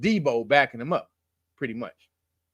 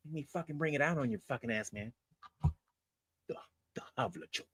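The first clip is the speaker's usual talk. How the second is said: menacing whisper; 4 semitones lower, 15 dB quieter, faster than usual. Pretend. Mock threat whispered.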